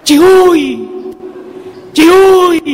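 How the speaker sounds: loud, high rowdy shouting; mock drunken whoop; drunk man's yell. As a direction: holler it like a drunk calling out from the street. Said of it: "dia teriak".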